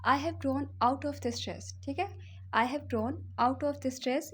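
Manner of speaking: slow, word by word model sentence; slow teaching model. Shown as a demonstration. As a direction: dictate it slowly to the class. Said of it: "इस तरह से भी कह सकते हैं". (धीमा, साफ़ उच्चारण)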